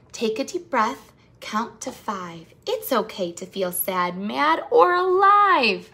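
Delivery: slow, calm read-aloud that swells on the last word; soothing rhyme reading. Acted. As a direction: guide the kids through breathing, slowly. Rhyming calming book read aloud.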